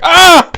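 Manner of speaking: very high shriek of panic; orc panicked shriek. Acted. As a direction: shriek while batting at flames. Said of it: "He's like".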